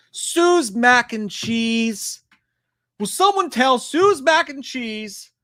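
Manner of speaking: booming call-out. Pretend. Streamer calling out a chatter's name, mock booming. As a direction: bellow the name like an announcer.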